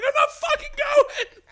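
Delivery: high, slow, drawn-out panicked yell; hammed-up shrieking refusal; losing it. Skydiver planted in the corner refusing to jump, losing it. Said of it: "I'm losing my shit".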